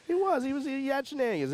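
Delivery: gleeful high exclamation; excited high outburst. Real, not acted. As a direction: squeal it out with glee. Excited retelling of culprit.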